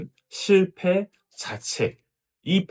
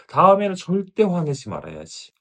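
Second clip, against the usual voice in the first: louder, higher, determined vow to herself; firm resolve; 9 semitones higher, 7 dB louder than usual. Retold or imitated. Mother swearing she'll never get angry again, firm and loud. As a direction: swear it out loud like you mean it. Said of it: "그래서 더 강하게 결심합니다".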